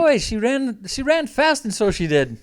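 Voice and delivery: high-pitched, hammed-up Irish lilt; attempted Irish accent. Podcaster asked for his best Irish accent, hammed-up Irish lilt. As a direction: have a go at a mock Irish brogue. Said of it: "Give me your best Irish accent".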